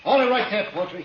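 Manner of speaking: sharp loud halt; shouted warning. Acted. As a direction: freeze him with a yell. Gunman stops approaching man.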